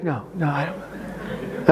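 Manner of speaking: soft, slow, small voice as the wife; acted reluctant little no; meek denial. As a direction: deny it weakly, very quietly. Acting out wife's reluctant denial.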